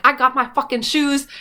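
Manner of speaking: hammed-up witch bragging about her shoes; gloating Wicked Witch. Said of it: "the Wicked Witch of the West is like".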